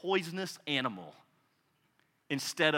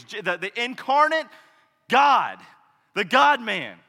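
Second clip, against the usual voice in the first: ordinary talk, nothing marked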